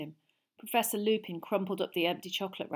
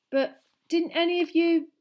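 awkward; 10 semitones higher, 8 dB louder than usual. A narrator's reading. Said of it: "said Harry awkwardly".

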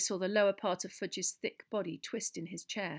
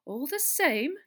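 raised voice, gently objecting; louder, higher objection; demurring; 9 semitones higher, 7 dB louder, slower than usual. A narrator's reading. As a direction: push back politely. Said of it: "demurred Fudge".

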